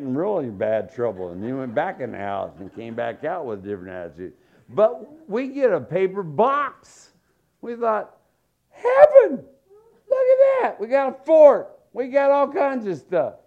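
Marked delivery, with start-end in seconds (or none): 4.7-13.3 high, loud squeal of wonder; thrilled kid. Retold or imitated. Kid shrieking to his brother about a cardboard fort, high and loud. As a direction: marvel at the box like it's treasure.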